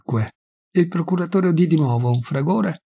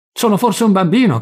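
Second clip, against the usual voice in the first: louder and higher, stung and indignant; indignant rebuke; 6 semitones higher, 5 dB louder than usual. A narrator's reading. Pilate rebukes the high priest, indignant and loud. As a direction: throw the question back at him.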